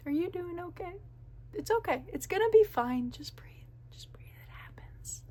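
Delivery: soft whisper, just breathe; calm her down in a hush; soothing whisper. Retold or imitated. Manager soothing the upset cashier in a soft whisper.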